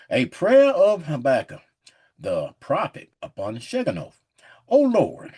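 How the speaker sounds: slow, weighty King James reading; measured, booming Bible reading; solemn scripture reading. Acted. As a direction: read it like a preacher at the pulpit. Reads scripture aloud.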